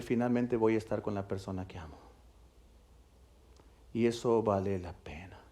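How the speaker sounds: soft, hushed and tender, trailing into a whisper. Retold or imitated. A groom dreaming of his bride, whispered softly. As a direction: breathe it like a private promise to yourself.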